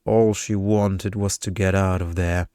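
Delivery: ordinary delivery, nothing marked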